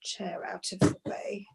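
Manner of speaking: a soft unvoiced whisper to herself while moving the chair; whispered aside. Real, not acted. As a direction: mutter it under your breath as an aside. Moving a chair aside mid-show.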